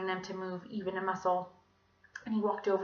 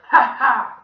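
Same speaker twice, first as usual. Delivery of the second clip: loud, mocking ha-ha; gloating villain laugh; 5 semitones higher, 17 dB louder than usual. A narrator's reading. Villain mocking a crying child, gloating. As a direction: cackle it out loudly.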